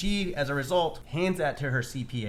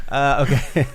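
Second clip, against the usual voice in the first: laughing; 8 dB louder, slower than usual. Real, not acted. Laughing after joke about help.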